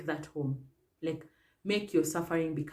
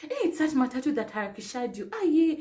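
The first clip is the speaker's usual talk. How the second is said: high, fussing voice of a kind passer-by; concerned stranger; 11 semitones higher, 5 dB louder than usual. Retold or imitated. Passer-by helping a fallen woman up, anxious and soothing. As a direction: fuss over her like a worried stranger. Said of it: "this lady's like".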